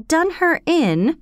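baffled, drawing the words out; confused. A narrator's reading.

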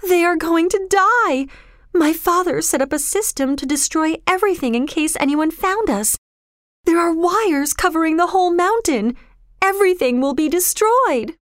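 put-on high, upset girl's voice; acted distress, pitched well up; Kismeen, distressed. A narrator's reading. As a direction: deliver it high and desperate, as if near sobbing. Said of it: "sobbed Kismeen".

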